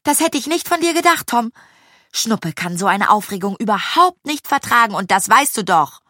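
high, reproachful telling-off; annoyed scolding. A narrator's reading. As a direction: scold a friend crossly. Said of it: "stimmte Carolina ärgerlich ein".

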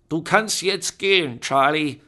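put-on loud, high, commanding old woman; Grandma Bone dismissing. A narrator's reading. Grandmother sending Charlie away, loud and commanding. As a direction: dismiss him firmly. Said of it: "sagte Grandma Bone".

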